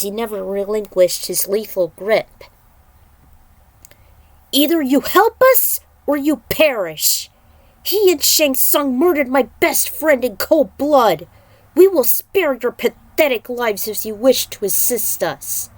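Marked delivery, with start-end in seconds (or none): 4.5-15.7 high, loud, furious warrior ultimatum; a loud, raging demand from a grieving fighter; furious threat. Acted. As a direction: threaten him like you mean to kill. Kung Lao threatens the captured demon.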